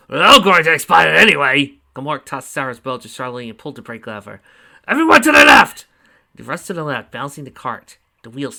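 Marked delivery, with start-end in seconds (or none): 0.1-1.8 booming character shout; loud gruff shout. Acted. Gamork shouts in the cart. 4.9-5.9 high, very loud yell; shouted command. Acted. Gamork yells a command.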